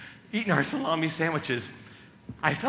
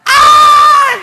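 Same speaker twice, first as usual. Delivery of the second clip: very high, very loud scream; mock falling scream; 21 dB louder than usual. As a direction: scream as if plummeting off a cliff. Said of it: "You yell".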